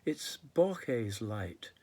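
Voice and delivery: measured, projected poet's recital; poetry read out to an audience; poem reading. Acted. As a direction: recite the verse with deliberate phrasing. Reads third poem aloud.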